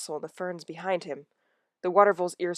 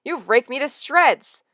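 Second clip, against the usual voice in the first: sore complaint; 8 semitones higher, 8 dB louder, slower than usual. Acted.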